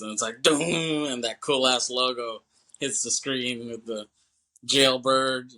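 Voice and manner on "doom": drawn-out, higher and booming "doom"; imitated music hit. Movie fan imitating the music as the logo hits, booming. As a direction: imitate a dramatic title-card boom. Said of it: "the music kinda crescendos".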